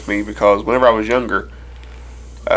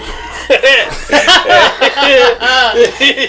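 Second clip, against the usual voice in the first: high loud burst of laughing; loud laughter; 12 semitones higher, 7 dB louder than usual. Real, not acted. Laughing hard at the TikTok joke with friends. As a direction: crack up at the joke.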